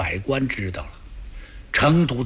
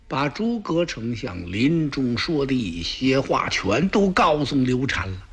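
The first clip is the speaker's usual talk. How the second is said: plain reading voice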